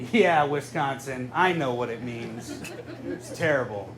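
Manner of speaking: usual speaking voice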